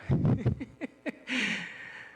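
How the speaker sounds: short breathy high laugh; a quick unvoiced giggle. Real, not acted. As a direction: let a real laugh slip out. Laughs after mentioning his wife.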